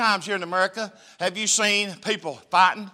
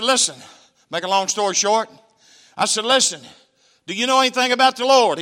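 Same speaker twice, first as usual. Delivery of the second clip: raised preaching voice pressing him; loud urging; 5 semitones higher, 8 dB louder than usual. Retold or imitated. Preacher urging a wounded gang member, raised voice. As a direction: call him out to listen.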